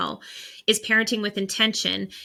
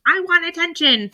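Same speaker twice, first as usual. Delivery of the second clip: put-on whiny kid; 9 semitones higher, 4 dB louder than usual. Child voiced clinging for attention.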